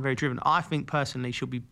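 usual speaking voice

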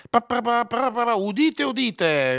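high fanfare pa pa, then crier's call; town crier. Shown as a demonstration. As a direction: herald trumpeting the opening. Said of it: "il cantore dell'inizio della trasmissione".

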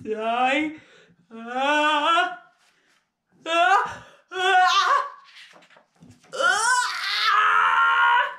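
pre-sneeze build-up. Acted. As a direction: build towards a huge acted sneeze.

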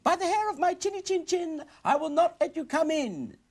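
very high piggy voice; little pig voice. Acted. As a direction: squeak defiantly like a little pig.

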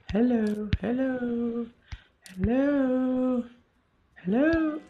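rising chain of squeaky high hellos; falsetto hellos. Pretend. Calling out for chat to talk.

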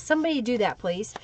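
ordinary delivery

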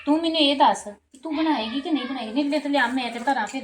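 high, loud demanding question; angry demand. Acted. Sister-in-law demands the girl say if she will cook, loud and angry. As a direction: press her hard for an answer.

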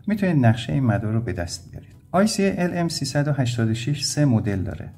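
usual speaking voice

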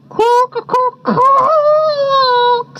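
quacks leaping high, the last drawn out; thirsty quacker. A narrator's reading. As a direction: quack like a bird, stretching the last one. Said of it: "said a thirsty fellow".

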